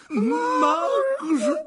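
long, loud, high scream of the name; horrified cry. A narrator's reading. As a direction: call out to the aunt in alarm. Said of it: "s'écrièrent ensemble l'oncle Vernon et la tante Pétunia". (cri d'effroi aigu et prolongé)

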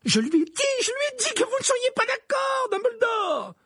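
very high, furious shout; thundering. A narrator's reading. Professor protests to Dumbledore, thundering. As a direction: shriek the protest, pitched right at the top. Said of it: "tonna le professeur McGonagall".

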